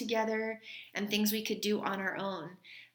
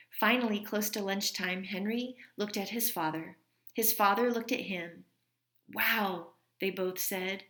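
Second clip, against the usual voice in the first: gentle read-aloud storytelling voice; story reading. A narrator's reading. Teacher reading a story to her class, gentle read-aloud. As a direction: read it like a teacher sharing a picture book with kids. Reads a picture book aloud.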